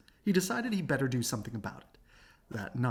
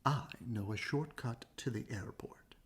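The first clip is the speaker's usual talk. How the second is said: whispered, conspiratorial fox; scheming fox whispering a trick; sly whisper; 4 semitones lower, 8 dB quieter than usual. Acted. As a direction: lure them in with a hush. Fox lures birds to his cave.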